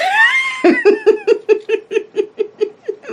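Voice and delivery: very high, loud giggling fit; helpless laugh. Real, not acted. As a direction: crack up in a long, high-pitched laugh. Cracking up at own story.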